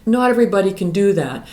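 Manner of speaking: ordinary delivery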